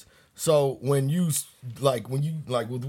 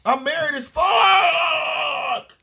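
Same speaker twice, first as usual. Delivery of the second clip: much higher, loud and slow; proud shout; 13 semitones higher, 5 dB louder, much slower than usual. Real, not acted.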